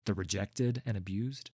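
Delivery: plain reading voice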